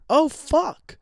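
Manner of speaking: high-pitched mock "oh no" moment; mildly panicked, pitched well up; retold dawning panic. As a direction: act out realizing you messed up badly. Retold sudden panicked realization.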